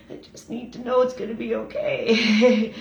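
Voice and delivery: begging for reassurance with a laugh; laughing plea. Retold or imitated. Telling her therapist what she needs, laughing. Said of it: "And I said to her".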